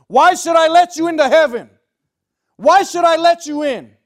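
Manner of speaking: booming high-pitched full shout; thunderous interrogating shout from on high; thundering voice. Acted. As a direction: roar it out as God's judgment. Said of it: "you just hear this voice thunder".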